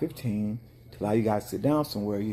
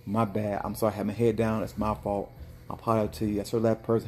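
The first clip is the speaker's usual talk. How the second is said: usual speaking voice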